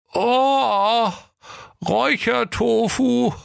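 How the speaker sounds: very high, slow cop voice, overwhelmed; faint officer. Acted. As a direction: gasp it out before fainting. Officer smells the ogre's breath.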